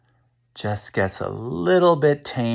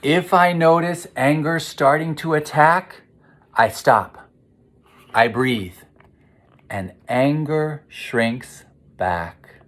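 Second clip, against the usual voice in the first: steady rhyme, pausing on stop and breathe; reading to children; 5 semitones higher than usual. A narrator's reading. Reads rhyming picture book aloud.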